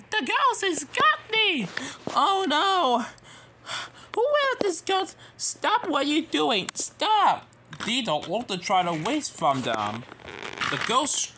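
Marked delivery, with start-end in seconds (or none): 0.1-7.5 high, loud frantic shouting; panicked cry. Acted. Nia begs the ghost to stop, panicked.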